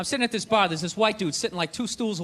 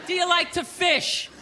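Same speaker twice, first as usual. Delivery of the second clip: higher, slow, deliberate spelled-out coaching; mock advice; 4 semitones higher than usual. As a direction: lay out each question slowly, teasing. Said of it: "it's like".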